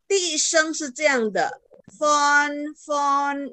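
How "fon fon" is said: FON held high and level; long flat high tone on "fon"; first tone. Shown as a demonstration. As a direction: sustain "fon" on one even high pitch. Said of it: "ini satu".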